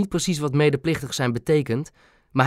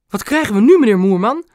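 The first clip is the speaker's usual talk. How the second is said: high, loud theatrical outcry; feigned indignation; 8 semitones higher, 7 dB louder than usual. A narrator's reading. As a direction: exclaim it loudly, pretending to be outraged. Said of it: "gespeeld verontwaardigd". (luid en hoog gespeeld verontwaardigd)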